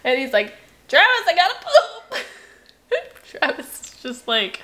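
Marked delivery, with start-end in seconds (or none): 0.9-2.2 put-on child's voice.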